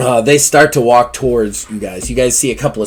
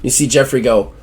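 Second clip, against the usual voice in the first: usual speaking voice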